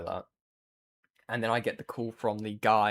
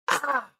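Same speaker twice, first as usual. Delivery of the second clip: short high shriek of frustration; frustrated yelp; 4 dB louder than usual. Retold or imitated. Streamer fighting the taped-up delivery bag, frustrated high yelp. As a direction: yelp at the wall of tape.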